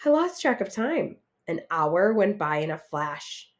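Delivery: ordinary delivery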